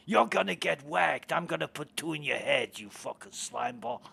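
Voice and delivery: mock New York mobster accent. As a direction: threaten them like a New York mafioso. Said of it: "we used to tell them they were gonna get whacked".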